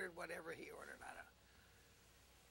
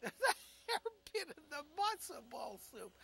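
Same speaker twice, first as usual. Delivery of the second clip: laughing through the line; laughing retelling; 5 semitones higher, 3 dB louder than usual. Retold or imitated. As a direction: giggle the words out in disbelief. Laughing repeat of punchline.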